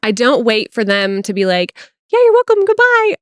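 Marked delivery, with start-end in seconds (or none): 2.1-3.2 very high-pitched mock phone farewell; hammed-up, high goodbye; imitated peppy reply. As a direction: mimic a chirpy caller signing off. Imitating the caller's answering peppy sign-off.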